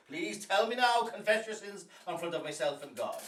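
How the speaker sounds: stammering priest, building to loud demand; flustered priest. Acted. Priest demanding a parishioner's confession, agitated and loud.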